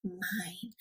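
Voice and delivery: breathy whisper on the last word. Acted. Teacher closing the refrain for the kids in a whisper. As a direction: let the final word fall to a whisper.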